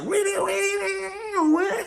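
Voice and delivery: squealing, very high mock wail; a hammed-up strangled cry; wild high wail. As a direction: screech it like a punk frontman. Imitating Keith's wild vocal.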